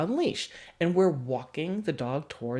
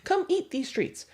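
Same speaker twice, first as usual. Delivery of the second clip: much higher, sing-song siren call; luring siren; 13 semitones higher than usual. Retold or imitated. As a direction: lure the tiny creature in like a siren. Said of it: "like this siren on the sea".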